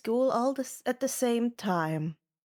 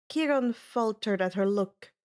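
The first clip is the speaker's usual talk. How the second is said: usual speaking voice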